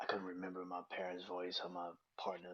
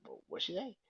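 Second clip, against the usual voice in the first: startled disbelief; 11 semitones higher, 4 dB louder than usual. Retold or imitated. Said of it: "you're like".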